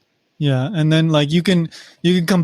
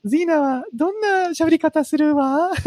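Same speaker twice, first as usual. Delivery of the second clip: put-on much higher, girly anime heroine voice; mock dub voice; 12 semitones higher than usual. Friends joke about Xena dubbed in Japanese, girly voice. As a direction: squeal it like a prim dubbed lady.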